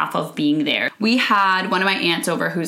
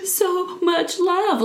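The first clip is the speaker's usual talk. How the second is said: much higher, very slow, choked-out words; quoted tearful child; 11 semitones higher, much slower than usual. Retold or imitated. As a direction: squeeze the words out through tears. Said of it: "she's crying and she got those words out".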